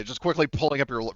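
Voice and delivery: ordinary delivery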